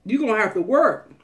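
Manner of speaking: God's command. Retold or imitated. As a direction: call it out loud like a stern command. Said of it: "God told us".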